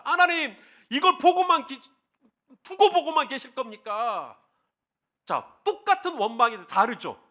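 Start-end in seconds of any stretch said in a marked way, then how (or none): 0.0-4.4 higher, pleading complaint to God; a lightly voiced Moses pressing God; Moses complaining to God. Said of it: "하나님 앞에 원망을 합니다".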